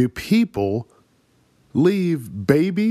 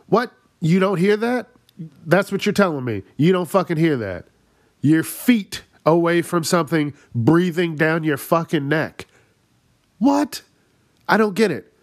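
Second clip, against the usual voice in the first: higher, incredulous ranting; 6 semitones higher than usual. Real, not acted. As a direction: rant in disbelief. Ranting in disbelief.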